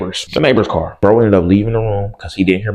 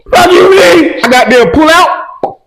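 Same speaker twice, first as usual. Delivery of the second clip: panicked yell; 7 dB louder, slower than usual. Retold or imitated. Panicked outburst retold.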